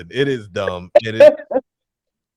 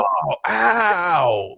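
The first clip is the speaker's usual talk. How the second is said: fake hurt; 5 semitones higher than usual. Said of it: "it'd just be like".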